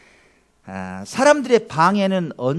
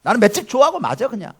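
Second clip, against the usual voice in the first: defiant beggar; 7 semitones higher, 7 dB louder than usual. Pretend. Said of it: "안 들어".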